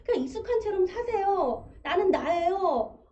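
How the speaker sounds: mock-proud slow "나는 나예요"; confident. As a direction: proudly declare self-acceptance, a little hammed-up. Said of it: "뭐 이렇게 당당하세요". (당당한 척하는 말투)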